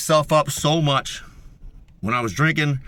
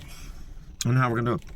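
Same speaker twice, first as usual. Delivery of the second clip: much softer, lower repeat of I don't know how we're gonna do it; muttered aside; 4 semitones lower, 8 dB quieter than usual. Real, not acted. Ranting about accountability, muttering to himself. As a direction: drop the line to a low mutter. Repeats the line, under breath.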